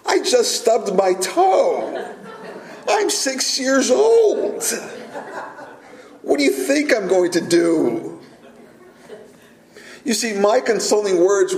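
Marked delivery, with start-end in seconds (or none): 0.0-8.2 put-on higher, slow, laughing kid voice with a grin; six-year-old son. Son scolds his father for fussing, giggly child voice. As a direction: play the little boy shrugging off his stubbed toe. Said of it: "He says".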